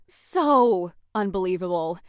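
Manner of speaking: low, drawn-out mock agreement; dry sarcasm. Jaded hero mocking talk of Mario's adventures, dry sarcasm.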